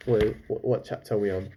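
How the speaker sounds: usual speaking voice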